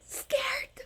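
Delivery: whispered, high and breathless. Real, not acted. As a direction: whisper it as if frightened. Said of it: "and I'm".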